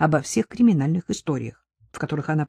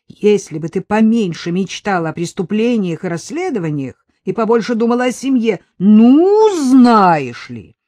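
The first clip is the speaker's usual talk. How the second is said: loud, high indignant outburst; rising to a furious peak; wife bristles and hits back at her husband; flaring up; 5 semitones higher, 8 dB louder than usual. A narrator's reading. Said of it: "вскинулась Надежда".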